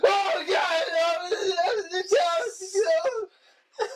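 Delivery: very high, loud shrieks of pain; mock agonized screaming. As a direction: scream like a host being stung, hammed up. Imitates host suddenly being stung.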